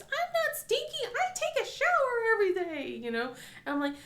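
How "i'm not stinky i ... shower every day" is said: put-on high, upset child protest; indignant kid. Son yelling at the girls, a high protest. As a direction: protest like a hurt little boy. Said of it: "he was yelling at them".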